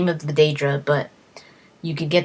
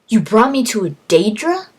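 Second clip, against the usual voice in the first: higher, louder incredulous question; outraged disbelief; 5 semitones higher, 6 dB louder than usual. Retold or imitated. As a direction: accuse the dog, shocked. Outraged at the follower.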